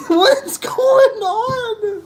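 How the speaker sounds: high, slow, cracking with laughter; laughing disbelief. Real, not acted. Ranting about language while laughing in high disbelief. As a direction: ask it while laughing helplessly.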